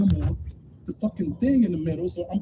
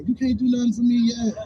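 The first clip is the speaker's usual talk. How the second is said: mock dismissive; 4 semitones higher, 5 dB louder than usual. Joking to the crackhead lady.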